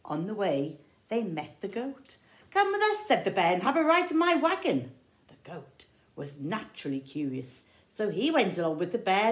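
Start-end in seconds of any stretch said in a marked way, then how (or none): 2.5-3.1 high, loud bear invitation; bright hammed-up bear calling. 3.5-4.9 high, loud bear invitation; bright hammed-up bear calling.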